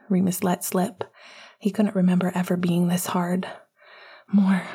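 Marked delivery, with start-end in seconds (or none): 4.3-4.6 breathless plea between breaths; panting. Acted. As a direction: gasp it out breathlessly. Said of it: "Sirius panted".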